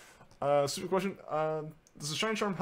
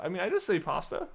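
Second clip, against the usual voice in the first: ordinary talk, nothing marked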